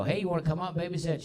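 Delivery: high, fast, hopeful invite; eager parents asking grandma. Retold or imitated. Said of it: "we go".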